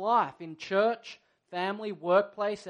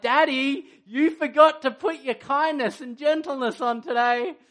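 lightly voiced young daughter, a bit higher and louder; daughter's voice; 5 semitones higher, 6 dB louder than usual. Daughter reminding her irritated dad about kindness, light child voice. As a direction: play his little girl gently teasing her dad. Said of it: "Maisie comes over to me and she's like".

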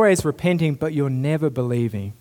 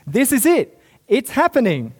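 excited proclamation; 8 semitones higher, 3 dB louder than usual. Acted. Preacher proclaims good news excitedly.

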